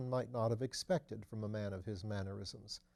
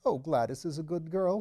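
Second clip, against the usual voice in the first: higher, louder fussy nervous voice; nervous little man; 6 semitones higher, 5 dB louder than usual. A narrator's reading. Nervous Phipps fussing to his lordship, higher and louder. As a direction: fret anxiously up in the voice.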